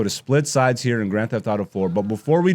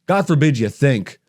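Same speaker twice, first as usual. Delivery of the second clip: ordinary talk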